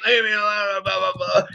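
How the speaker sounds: dismissive imitation of screaming; mock scream. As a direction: imitate a screamer in a half-hearted wail. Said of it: "And she starts screaming".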